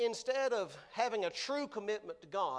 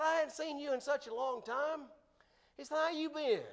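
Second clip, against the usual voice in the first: friendly greeting; 8 semitones higher than usual. Retold or imitated. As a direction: call out cheerfully to an old friend. Guy greets Joe at gym.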